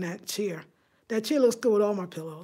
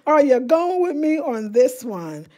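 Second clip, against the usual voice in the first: loud, slow, every word leaned on; emphatic re-ask; 3 semitones higher, 7 dB louder than usual. Real, not acted. Vlogger pressing viewers to join her plan, loud and emphatic. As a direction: ask it big and deliberate, spacing out the words. Repeats question to rally viewers.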